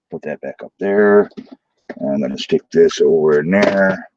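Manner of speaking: ordinary talk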